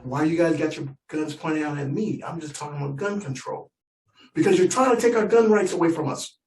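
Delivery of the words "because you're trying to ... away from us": indignant gun owner. Retold or imitated. Gun owner accusing the nephew, mock indignant. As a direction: puff up and defend the guns.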